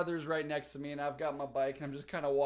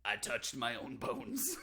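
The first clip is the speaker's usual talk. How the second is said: normal talking voice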